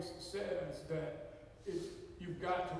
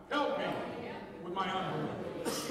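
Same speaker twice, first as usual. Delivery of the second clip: louder repeat; 2 semitones lower, 4 dB louder than usual. Real, not acted. Congregation repeating once more, louder. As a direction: call it out stronger the second time. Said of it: "One more time".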